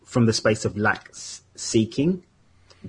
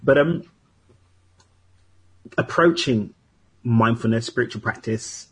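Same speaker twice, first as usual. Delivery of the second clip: usual speaking voice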